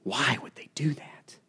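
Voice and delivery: slow, hushed whisper. Real, not acted. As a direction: lean in and whisper the question. Rhetorical question whispered to congregation.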